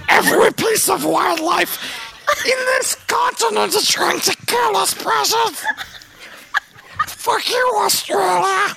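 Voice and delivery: put-on very high shout with an Australian accent; shrieking Australian-accented Gollum rant. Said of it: "Now do Australian".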